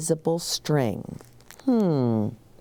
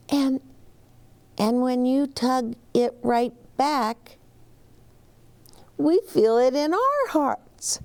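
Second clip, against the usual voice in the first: lightly voiced child; 8 semitones higher than usual.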